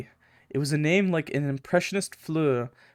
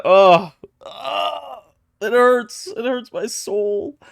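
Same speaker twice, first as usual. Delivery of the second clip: mock anguish; 8 semitones higher, 5 dB louder, slower than usual. Mock anguish over smut.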